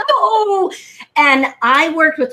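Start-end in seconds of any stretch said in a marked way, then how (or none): 0.1-0.7 high, wailed mock sob; hammed-up weepy wail; mock crying. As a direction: imitate a tearful, drawn-out protest. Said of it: "crying like".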